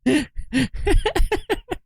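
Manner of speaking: high-pitched burst of laughter; cackling at the image. Real, not acted.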